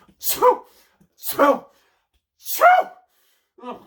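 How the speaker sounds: loud high sneezes then a groan; big sneezes. Acted. Merlin sneezing in the bush, loud. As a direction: sneeze three times hard.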